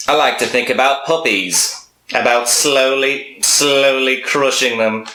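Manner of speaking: high, slow, sinister little gnome; creepy gnome voice. Retold or imitated. Gnome voice talking smack.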